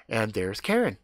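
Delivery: usual speaking voice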